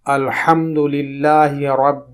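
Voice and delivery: measured Quranic recitation; unhurried tajweed reading; slow recitation. Shown as a demonstration. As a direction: chant العالمین slowly, pressing the ع.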